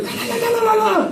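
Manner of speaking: very high gibberish yell; mock yelling wife. Wife yelling at half-asleep husband in Spanish, mocked high. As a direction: squeal it high like she's scolding. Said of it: "she's yelling at me".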